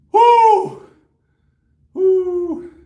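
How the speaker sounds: high loud whooping after a lift; triumphant whoop. Real, not acted. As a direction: whoop in release. After finishing the set.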